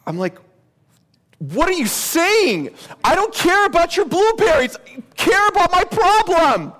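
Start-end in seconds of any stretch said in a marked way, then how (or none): 1.4-6.7 much higher and louder, fed up, pushing back; exasperated outburst. Retold or imitated. Seminary student to his pastor friend, exasperated outburst. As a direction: cut him off with frustration: enough about blueberries.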